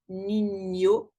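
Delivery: niño with the ñ as ny; niño pronounced nee-nyo; Spanish ñ. Shown as a demonstration. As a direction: say niño with a clear ny glide. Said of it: "ça se prononce comme ye".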